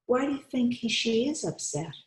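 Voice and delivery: plain reading voice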